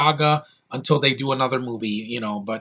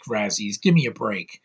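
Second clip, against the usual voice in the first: ordinary delivery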